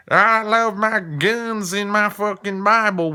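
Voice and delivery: high, loud, slow Southern drawl mockery. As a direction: ham up a gun-loving Southern churchgoer. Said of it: "nobody else talks like that".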